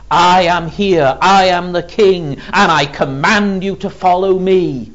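loud, high, ringing declaration; forceful, sustained royal summons; kingly command. Retold or imitated. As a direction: proclaim your authority as king. Voices Jesus commanding as king.

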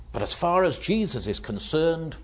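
ordinary talk

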